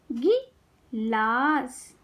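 गिलास very slowly, pause after गि; syllable by syllable. Shown as a demonstration. Teacher teaching kids to read, syllables apart. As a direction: break गिलास into गि - लास. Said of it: "शब्द पढ़ना सिखाऊंगी".

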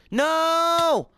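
panicked high scream. Real, not acted. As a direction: shriek it out in panic.

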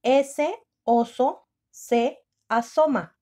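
very slow, word by word; drawn-out dictation. Shown as a demonstration. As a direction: dictate slowly to the class. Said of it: "Primer tiempo".

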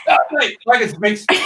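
excited retold dream exclamation. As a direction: burst out with amazed delight. Excited dream retelling to friends.

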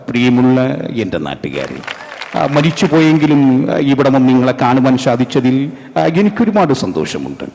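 a dead star greeting his fans fondly; Nazir impression. Actor's ghost greeting his fans, gentle film-hero address. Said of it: "സ്ഥിരം ഒരു ശബ്ദമുണ്ട്".

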